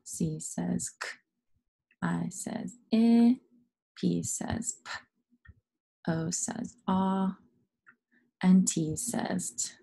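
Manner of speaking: slow, each sound said alone after "says"; name each letter, then give its bare sound slowly. Shown as a demonstration. Teacher spelling topic away, slowly sounding out each letter. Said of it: "Spell it away".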